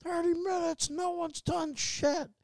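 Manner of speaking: very high, strained mock yell at normal volume; imitated yelling. ER patient complaining about the wait, imitated high yell. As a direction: imitate a fed-up patient hollering down the corridor. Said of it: "he started yelling".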